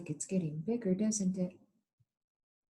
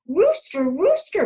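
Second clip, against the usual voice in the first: high, loud call; calling across the yard to Rooster; 13 semitones higher, 10 dB louder than usual. Acted. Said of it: "called Hen".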